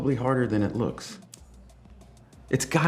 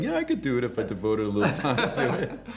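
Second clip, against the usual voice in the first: mocking boastful; 5 semitones higher than usual. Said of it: "Who are like".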